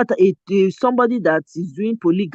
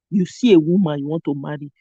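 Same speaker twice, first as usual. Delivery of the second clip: ordinary talk, nothing marked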